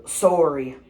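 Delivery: mock Canadian "sorry"; retold apology in a Canadian accent; Canadian-style sorry. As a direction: say "Sorry" with a rounded Canadian "sore-ee". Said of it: "then Kathy's like".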